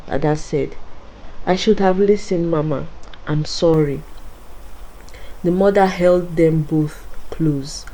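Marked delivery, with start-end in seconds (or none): none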